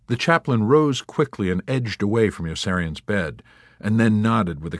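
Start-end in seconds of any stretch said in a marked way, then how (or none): none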